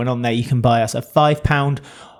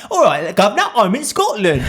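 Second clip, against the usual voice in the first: mock Cockney; 7 semitones higher than usual. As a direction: tease the friend with a hammy London accent. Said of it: "I know I do bad accents".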